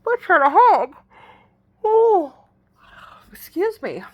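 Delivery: loud, high-pitched disbelief at sudden news; incredulous surprise. Retold or imitated. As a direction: react as if totally blindsided. Replays surprise at his announcement.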